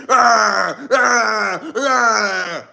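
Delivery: hammed-up fake sobbing; mock crying wail. Streamer mocking crying Democrats, fake sobbing wail. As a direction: wail like a melting-down protester. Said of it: "That's exactly how the Democrats sound".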